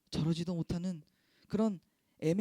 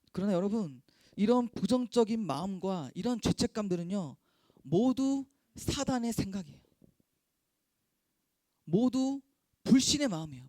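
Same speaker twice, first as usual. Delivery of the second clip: louder, pressing preacher's voice; building sermon; 4 semitones higher, 5 dB louder than usual. Acted. Pastor rebuking guilt as Satan's thought, pressing fervent preaching. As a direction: press the point home to the congregation.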